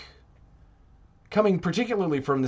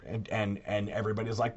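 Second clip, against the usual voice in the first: normal talking voice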